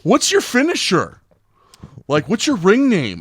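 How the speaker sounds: very high, loud eager questions; excited kids. Retold or imitated. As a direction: pepper the coach like starstruck kids. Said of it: "Three kids come up to me".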